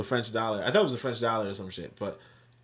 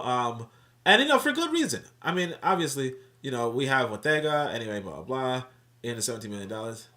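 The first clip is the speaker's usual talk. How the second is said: usual speaking voice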